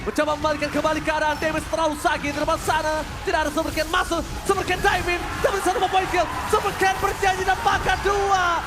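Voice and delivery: loud, high hyped play-by-play; esports caster. Acted. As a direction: call the fight at full hype. Hyped play-by-play of team fight.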